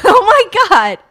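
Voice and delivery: loud, high burst of amazement; real astonishment. Real, not acted. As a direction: blurt it out in gleeful disbelief. Listener reacts to Target run-in.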